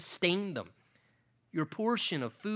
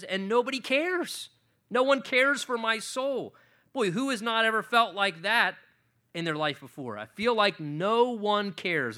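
fervent preaching; 7 semitones higher, 7 dB louder than usual. Acted. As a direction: lean into the loneliness, preaching. Preaching David's psalm.

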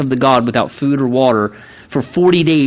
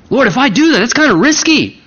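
higher-pitched mock worried objection; hesitant believer raising doubts, voice pitched up; worried pleading; 6 semitones higher than usual. Believer telling the Lord it's risky, worried and pleading.

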